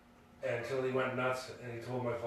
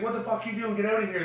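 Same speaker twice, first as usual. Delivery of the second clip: higher, furious mock yell; angry yell; 9 semitones higher, 4 dB louder, faster than usual. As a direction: chase them off your lawn. Ronnie yelling at intruders.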